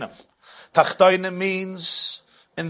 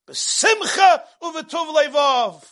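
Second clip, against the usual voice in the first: 1 emphatic verse; 9 semitones higher, slower than usual. Acted. Verse climax.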